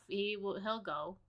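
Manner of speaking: usual speaking voice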